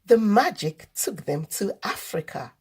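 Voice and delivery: ordinary delivery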